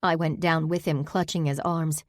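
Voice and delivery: usual speaking voice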